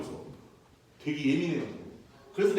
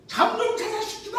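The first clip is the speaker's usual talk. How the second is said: much higher mock shout; yelling down at noisy kids in mock rage; hammed-up angry yell from the window; imagined yell; 13 semitones higher, 3 dB louder than usual. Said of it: "하고 싶었지만".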